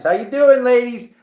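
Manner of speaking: high, loud holler to the ladies; playful called-out greeting. Pretend. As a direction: call out to family far away. Calling out to family in Carolinas.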